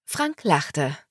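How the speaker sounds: ordinary delivery, nothing marked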